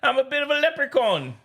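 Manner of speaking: high-pitched mock Irish accent; squeaky Irish leprechaun, played for laughs. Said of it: "accent do you have for us".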